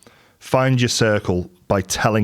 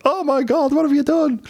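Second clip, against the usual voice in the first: very high, squeaky falsetto; exaggerated high-pitched horrified wife; mock wife impression. Husband mimicking his wife's reaction, exaggerated falsetto impression. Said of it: "She doesn't really talk that way, but similar".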